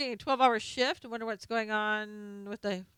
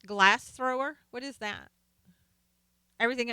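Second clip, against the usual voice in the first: usual speaking voice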